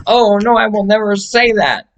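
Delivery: high mock child voice; kid's voice. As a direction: squeak it out like a scared kid. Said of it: "And the kid goes".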